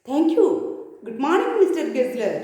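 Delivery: ordinary delivery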